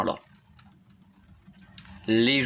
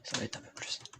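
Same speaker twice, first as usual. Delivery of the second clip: soft unvoiced whisper; 16 dB quieter, much faster than usual. Real, not acted. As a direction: whisper it as an aside. Repeats line whispered.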